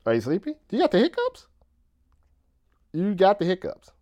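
high-pitched talking to a puppy. Real, not acted. Owner asks his hiccuping puppy, high puppy talk. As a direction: lift the voice high, cooing a question to a pup.

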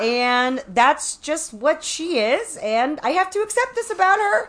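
high, loud imitation of a speech; hammed-up dad toasting the room; father giving a toast. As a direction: build to a big mock cheers. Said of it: "He gave a toast".

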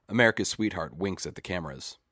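usual speaking voice

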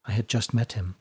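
softly spoken, subdued reply; quiet. A narrator's reading. Fiona tells Seinen of the drowned man, quietly. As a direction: say it quietly, dropping the volume. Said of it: "said Fiona quietly".

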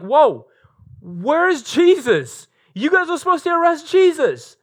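high, loud, outraged elders demanding answers; mock outraged Sanhedrin. Preacher voicing the council scolding the guards, high and outraged. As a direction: play the furious council, slowed and incredulous.

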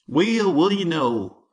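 high, wavering voice thick with twang; wavering twangy bully. A narrator's reading. Schoolyard bully taunting Jimmy in a high wavering twang. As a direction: twang it out like a badly tuned banjo. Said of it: "said a wavering, high-pitched voice full of more twang".

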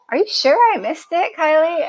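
high-pitched, sceptical query; retold doubting question.

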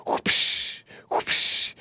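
voiceless "whoopsh" whip noise; imitated whip crack. Host mimics the media whipping the brain, imitated whip cracks. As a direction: mouth two soft whooshing lashes.

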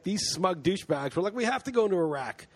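sarcastic mockery. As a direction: sneer at the pundits who pushed the war. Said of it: "and you're like".

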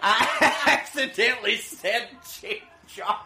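high, laughing excuse; laughing protest. Real, not acted. Host laughs while defending his slip.

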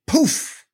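a playful poof as if something appears; poof sound effect. Shown as a demonstration. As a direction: pop out a magic poof noise. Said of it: "That's the sound I want to use".

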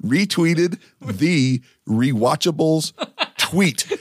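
"Retweeted the Rewatchables tweet" said slowly, every T, R and W spelled out; carefully slow so you don't trip on the T's. Shown as a demonstration. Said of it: "it's all T's, R's, and W's".

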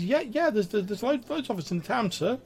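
high-pitched nervous little sprite; Grimwald, squeaky. Acted. Timid sprite answers where his kind live, squeaky. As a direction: answer in a tiny, stammering squeak. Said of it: "in, like, a really, like, squeaky, high-pitched voice".

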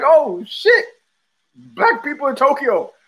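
mock surprise, pitched high; surprised exclamation. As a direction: act out a startled discovery. Acts out surprise at unexpected sighting.

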